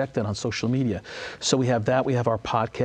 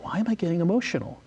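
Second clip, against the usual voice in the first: higher, quick, puzzled self-questioning; mildly moved, quick and higher; retold emotional bewilderment; 6 semitones higher, faster than usual. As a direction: recall being caught off guard by feeling. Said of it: "I, I, I got emotional".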